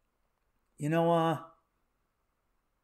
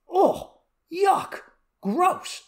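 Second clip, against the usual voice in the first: high-pitched, separated bursts of revulsion; grossed-out, pitched well up; disgusted; 10 semitones higher, much slower than usual. Real, not acted. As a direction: recoil in disgust on each word. Reacting with disgust to celebrity pregnancy.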